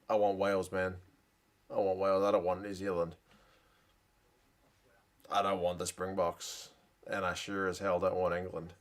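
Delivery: a hint of a low, hushed coach voice; lightly voiced coach. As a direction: murmur it like the coach picking teams, barely in character. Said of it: "And he's like".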